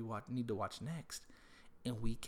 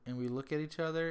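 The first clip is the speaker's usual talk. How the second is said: usual speaking voice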